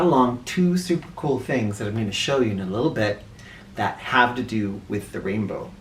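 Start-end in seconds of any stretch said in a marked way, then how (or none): none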